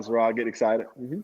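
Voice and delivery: normal talking voice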